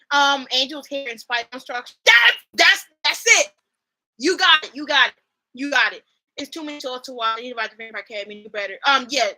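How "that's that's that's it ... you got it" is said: loud, thrilled exclamation; hyping up the ship with loud bursts; excited yell. Real, not acted. Vlogger cheering at the screen, loud and thrilled.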